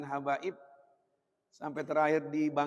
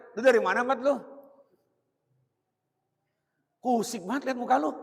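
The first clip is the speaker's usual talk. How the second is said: put-on loud, raised-voice ribbing of a child; mock Betawi scolding; 6 semitones higher, 6 dB louder, faster than usual. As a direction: imitate a blunt parent greeting his kid. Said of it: "Kalau kita orang bertanya".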